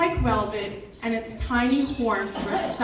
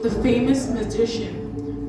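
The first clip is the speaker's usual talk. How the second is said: ordinary delivery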